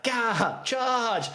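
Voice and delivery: high gladiator yell, American-style charge; gladiator battle cry. Retold or imitated. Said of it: "Russell Crowe, like, gladiator style".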